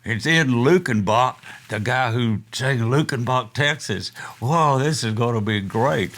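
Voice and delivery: retelling the crowd's buzz with rising excitement; excited crowd. Retold or imitated. Fans awaiting [name], retold with mock excitement. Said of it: "everybody's excited".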